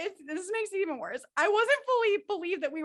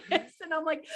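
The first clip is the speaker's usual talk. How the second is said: ordinary talk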